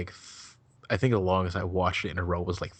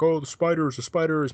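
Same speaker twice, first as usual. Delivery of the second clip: louder, higher mock panic; raised-pitch, hammed-up alarm; imitated freak-out; 8 semitones higher, 5 dB louder than usual. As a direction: act out someone freaking out over spiders. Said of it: "that guy was freaking out".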